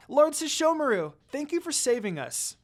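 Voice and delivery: put-on high, bright thankful child; smiling thanks. A narrator's reading. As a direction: thank him with a smile in the voice. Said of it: "Rin said with a smile".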